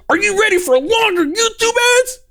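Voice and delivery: high, gushing hype-man read; mock excited. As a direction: oversell it like a thrilled ad salesman. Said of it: "Man, he's so excited for that".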